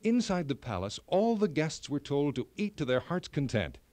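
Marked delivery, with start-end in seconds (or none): none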